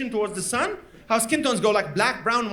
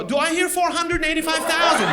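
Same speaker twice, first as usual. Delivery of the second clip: higher, louder mock auction call; auctioneer; 7 semitones higher, 3 dB louder than usual. Agent auctioning an Adelaide house, mock. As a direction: call bids like a cheap auction. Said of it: "I had to go".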